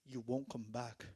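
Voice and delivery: put-on low, ominous African-accented warning; imitated African English accent. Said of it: "the guy that speaks English".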